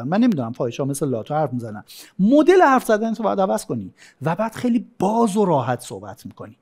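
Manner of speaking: ordinary talk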